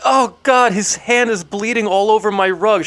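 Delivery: high, loud horrified whine; fussy hostess. Retold or imitated. Donna fussing over blood on her rug, high and loud. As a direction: shriek about the rug. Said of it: "she's like".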